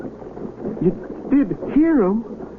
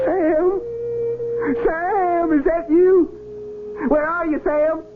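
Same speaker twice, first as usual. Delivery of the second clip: higher calls across the distance; calling out; 7 semitones higher, slower than usual. Acted. Soldier calls out to his dead comrade Sam, hoping he answers. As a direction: call out to a lost friend, straining to reach him. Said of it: "I must have been shouting".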